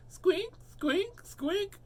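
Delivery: very high-pitched squeaking noise; storybook squeak sound effect. A narrator's reading. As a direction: imitate a squeak, thin and high.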